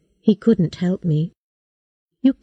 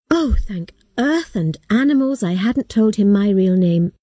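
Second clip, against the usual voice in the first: plain reading voice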